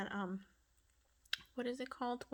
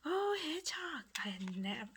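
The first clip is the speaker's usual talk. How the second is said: surprised whisper; 3 semitones higher than usual. Real, not acted. Crafter finding a hedgehog, whispering in surprise. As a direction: gasp it under your breath.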